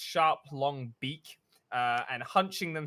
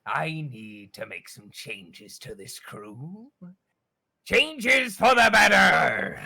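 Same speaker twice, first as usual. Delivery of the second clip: captain's vow; 8 dB louder, slower than usual. Acted. Captain announcing changes to his crew, building loud. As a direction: menace rising into a proclamation.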